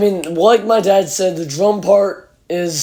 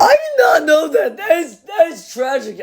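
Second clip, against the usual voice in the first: shocked; 9 semitones higher than usual. Real, not acted. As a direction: gasp it out in disbelief. Shocked by tragic story.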